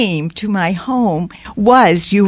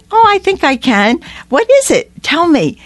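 high, bright-voiced coaxing to draw her out; much higher, eager and reassuring; eager reassurance; 9 semitones higher than usual. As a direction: lift the voice high to gently urge her to open up. Coaxing a weeping young woman.

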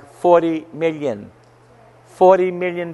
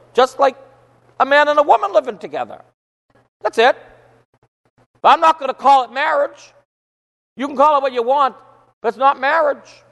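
emphatic retort; 11 semitones higher than usual. Retold or imitated. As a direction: firmly declare it, voice raised high. Retold firm stand in the argument.

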